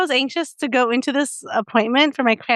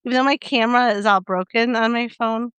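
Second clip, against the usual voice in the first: ordinary delivery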